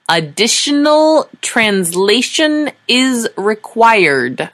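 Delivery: slow, loud, firm demand. A narrator's reading. Sora insisting to the envoys, slow and loud. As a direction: spell it out for the aliens, slow. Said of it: "she said loudly".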